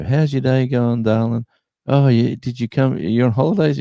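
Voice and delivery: high, friendly cashier voice; lightly voiced sweet checkout lady; second shop lady. Second supermarket cashier greeting a stranger, high and friendly. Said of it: "same sort of scenario".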